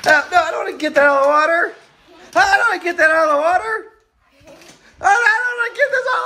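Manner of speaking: high, loud laughing whine; mock whining. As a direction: whine it like a sulky kid.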